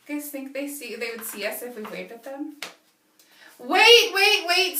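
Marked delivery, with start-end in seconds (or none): 3.6-4.8 loud, higher, cutting in; upset. Acted. Lila interrupting the story, loud and upset. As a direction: stop everyone short. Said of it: "she's super having a hard time".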